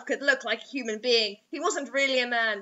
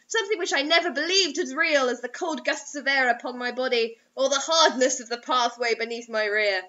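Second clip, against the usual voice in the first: normal talking voice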